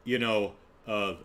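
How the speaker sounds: normal talking voice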